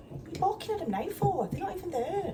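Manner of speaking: high-pitched exasperated telling-off; exasperated scolding. Retold or imitated. Imitating herself scolding the dog barking at nothing, high exasperation.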